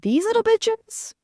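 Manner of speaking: very high and slow, dripping with scorn; mocking squeaky voice. Vlogger mocking the dramatic men on the show, in a high slow sneer. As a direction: stretch it out high like a sneer at whiny boys.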